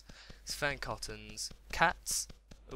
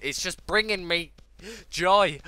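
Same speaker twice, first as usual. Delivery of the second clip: higher, louder burst on the last word; overjoyed; 7 semitones higher, 10 dB louder than usual. Real, not acted. Gamer explaining his excitement, overjoyed. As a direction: let the joy burst out. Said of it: "overwhelmed by the excitement".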